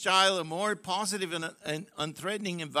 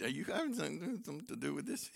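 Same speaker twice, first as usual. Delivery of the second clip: puzzled husband; 10 dB quieter, faster than usual. Pretend. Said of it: "look at his wife and said".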